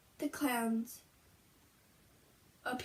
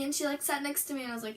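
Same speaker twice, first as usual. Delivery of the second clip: ordinary delivery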